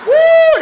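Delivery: very high, loud comic whoop; whooped "woo". Acted. Diner reacts to man eating potato, high mock whoop. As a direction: let out a big soaring whoop.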